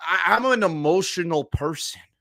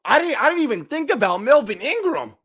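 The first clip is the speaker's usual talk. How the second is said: excited; 10 semitones higher, 4 dB louder than usual. Real, not acted. Excited fan repeating louder.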